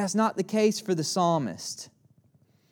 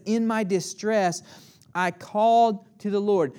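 louder and higher, ringing out the cry; loud plea; 4 semitones higher, 5 dB louder than usual. Acted. Preacher crying out the psalmist's words to the congregation, loud. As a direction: proclaim the psalmist's cry to the hall. And he says.

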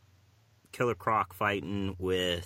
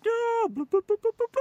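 high, loud scream dissolving into bubbles; shouting underwater; 5 dB louder than usual. Pretend. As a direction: scream then gurgle out bubble sounds. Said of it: "I like to imagine it as".

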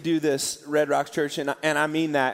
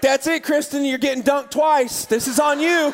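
mock scolding; 7 semitones higher, 4 dB louder than usual. Priest jokingly scolds swearing woman.

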